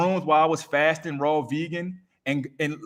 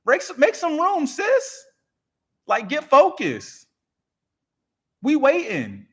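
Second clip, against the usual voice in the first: much higher, louder urging voice of the gift; the gift itself nagging, get focused; voice of your gift; 10 semitones higher, 3 dB louder, slower than usual. Acted. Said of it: "But your gift is like".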